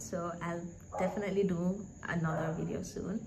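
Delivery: usual speaking voice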